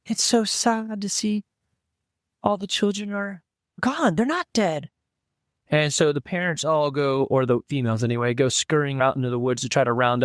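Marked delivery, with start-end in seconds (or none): 0.1-4.9 halfling woman. Pretend. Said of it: "I don't think I can do British. Yeah, it's a girl".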